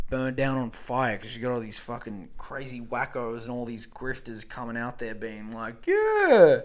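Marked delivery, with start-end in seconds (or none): 5.9-6.6 high, loud sneering "Yeah."; mock grifter voice. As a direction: squeal it like a smug grifter. Grifters mocked in acted quote.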